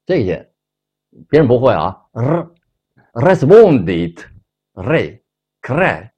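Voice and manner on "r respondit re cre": R，respondit，re，cre drawn out very slowly with a trilled R; rolled R demo. Shown as a demonstration. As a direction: roll every R slowly. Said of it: "他发这个音".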